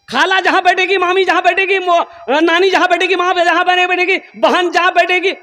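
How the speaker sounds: high mocking lilt listing where the aunties sit; mocking sing-song. Preacher mocking a youth who sits with the women, high teasing singsong. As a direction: tease him in a high singsong.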